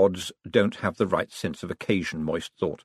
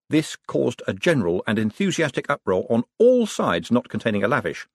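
ordinary delivery, nothing marked

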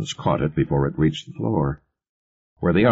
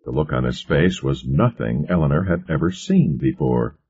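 ordinary delivery, nothing marked

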